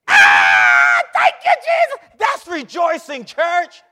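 loud, high rejoicing shout; shouting praise. Real, not acted. Preacher shouting praise to the church. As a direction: let it burst out of you.

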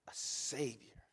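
breathy whisper of Savior. Shown as a demonstration. Preacher whispering the angel's Savior to congregation. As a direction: hush the word Savior. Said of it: "dramatic whispered repeat".

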